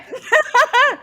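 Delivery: sudden high burst of laughter; loud laugh. Real, not acted.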